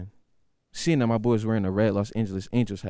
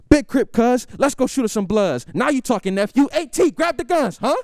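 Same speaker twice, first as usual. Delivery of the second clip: much higher, louder mock gangster; hammed-up gangster; 13 semitones higher, 4 dB louder than usual. Nephew faking gang bravado to uncle, loud and high. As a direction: play the hard Crip over the top. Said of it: "I go".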